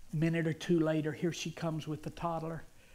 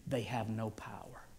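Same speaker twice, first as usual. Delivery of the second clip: Jesus reassuring; 5 semitones lower, 7 dB quieter than usual. Retold or imitated. Jesus telling Eddie thoughts have no power, softly reassuring. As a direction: lower and hush the voice, measured. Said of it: "And he said this".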